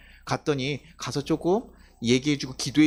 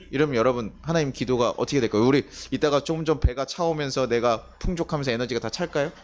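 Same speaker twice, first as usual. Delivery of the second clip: usual speaking voice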